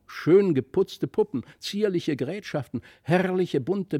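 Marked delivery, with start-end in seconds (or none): none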